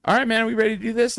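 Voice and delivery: high, fast and eager; eager old showman. Retold or imitated. Imitating Jimmy Hart rushing up to the panel, high and fast. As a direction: rattle it off like a hyped manager. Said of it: "he's like".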